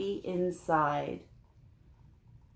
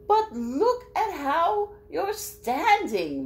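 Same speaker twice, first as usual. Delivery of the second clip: high, slow fussy bird; 11 semitones higher, 5 dB louder, slower than usual. Acted. Marabou voiced in story.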